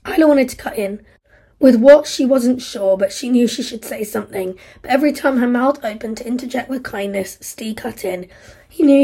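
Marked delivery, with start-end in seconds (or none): none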